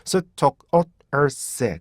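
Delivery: 스톡어스 very slowly, beat by beat; teacher's broken-down 스톡어스; syllable by syllable. Shown as a demonstration. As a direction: separate each syllable of 스톡어스. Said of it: "세 번째 음절에서".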